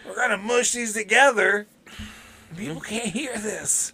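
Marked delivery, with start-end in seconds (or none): 2.6-3.9 slow, hushed aside; whispering. Real, not acted. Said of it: "I don't know why I'm whispering".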